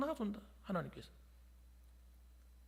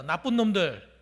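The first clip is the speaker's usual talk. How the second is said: louder call for the congregation to echo; call to repeat; 2 semitones lower, 8 dB louder, slower than usual. Shown as a demonstration. Pastor leads congregation in repeating, loud call. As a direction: say it out so they echo it. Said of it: "따라합시다".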